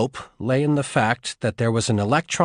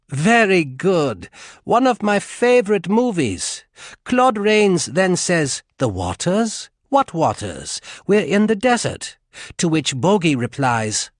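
smooth, higher amused officer voice; 6 semitones higher than usual. A narrator's reading. Officer chuckles to the prisoner about Casablanca, amused. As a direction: savour the movie quote.

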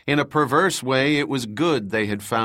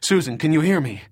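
urgent call; 5 semitones higher, 4 dB louder, faster than usual. Acted. Calling to unseen injured woman.

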